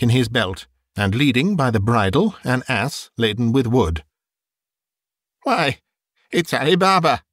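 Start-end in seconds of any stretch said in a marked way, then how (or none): 5.4-7.2 high, delighted outburst; ecstatic exclamation. A narrator's reading. Scrooge spots Ali Baba from his boyhood books, ecstatic. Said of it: "Scrooge exclaimed in ecstasy".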